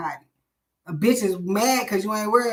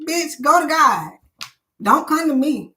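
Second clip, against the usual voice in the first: louder and higher, brushing them off; mock defiant yell; 5 semitones higher, 5 dB louder than usual. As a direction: snap it back at the agents. Voicing her answer to agents.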